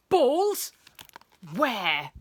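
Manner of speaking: loud, urgent questions; agitated teacher's sharp query; demanding. A narrator's reading. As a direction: demand an answer, first word pitched high. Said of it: "he demanded".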